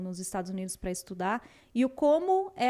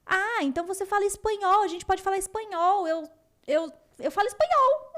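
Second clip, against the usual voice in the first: voz alta e aguda, animada; atendente animado; 9 semitones higher, 4 dB louder than usual. Retold or imitated. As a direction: imita o atendente empolgado. Atendente insistindo em espanhol. (atendente animado sugerindo falar espanhol)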